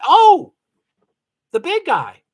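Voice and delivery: sudden realization. Real, not acted. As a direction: blurt it as it comes to you. Suddenly remembering a driver.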